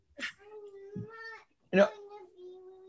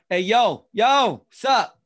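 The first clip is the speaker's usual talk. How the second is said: louder, higher buddy-style hey yo; casual slang greeting; 7 semitones higher, 9 dB louder, much slower than usual. Shown as a demonstration. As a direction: greet like a street pal. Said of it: "How about".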